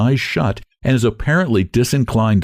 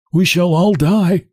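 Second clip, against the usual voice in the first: with a sigh; 10 semitones higher, slower than usual. A narrator's reading. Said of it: "says with a sigh".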